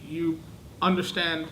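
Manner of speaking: usual speaking voice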